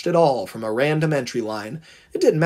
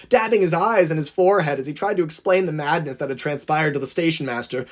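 usual speaking voice

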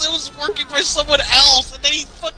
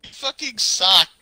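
ordinary talk

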